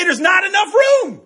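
high, slow alarmed shout; high-pitched shouted warning, drawn out. Retold or imitated. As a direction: yell the warning as the car pulls out. Retelling alarm on motorcycle.

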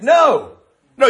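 a high, loud, sharp no. Real, not acted. Preacher interrupting a mocked excuse, sharp loud no. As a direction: cut the excuse off hard.

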